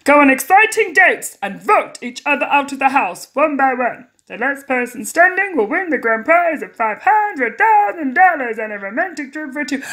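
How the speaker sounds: deliberately cheesy game show presenter; bad host voice. Host lists prizes to contestants, bad presenter voice. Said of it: "he needs a bad voice".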